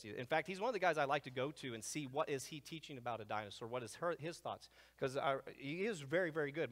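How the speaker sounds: ordinary talk, nothing marked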